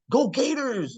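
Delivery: high-pitched mock pep-rally cheer; imitated school cheer. Orientation leader rallying new students, mock cheer.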